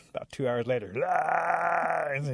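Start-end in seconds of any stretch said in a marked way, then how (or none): 1.1-2.1 long high loud "aah"; hollered wail. A narrator's reading. Rabbit keeps hollering from sticker bushes, mock wail. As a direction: sustain a put-on howl. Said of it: "He's still hollering".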